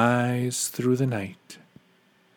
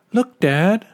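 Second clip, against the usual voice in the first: higher, louder eager call to Dad; excited child; 8 semitones higher, 6 dB louder than usual. A narrator's reading. Child spotting the first star for Dad, excited. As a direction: call out in wonder, pitched up high.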